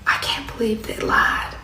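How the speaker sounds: hushed, near-whispered retelling; real quiet. Retold or imitated. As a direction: imitate her dropping to a quiet confession. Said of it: "she got real quiet".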